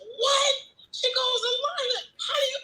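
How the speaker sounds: high, laughing astonished cry; can't believe it, laughing; laughing amazement. Retold or imitated.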